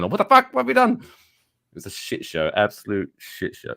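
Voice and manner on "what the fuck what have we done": high, panicked squeal of officials; hammed-up retold shriek; mock panicked screaming. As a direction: squeal it like the room is falling apart. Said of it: "the voices everywhere just screaming".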